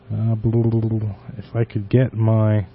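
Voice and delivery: normal talking voice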